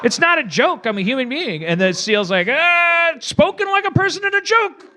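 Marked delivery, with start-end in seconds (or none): 0.0-1.6 indignant staff. Acted. Staff protests to the seal. 2.5-4.8 squeaky seal. Acted. The seal's parting shot.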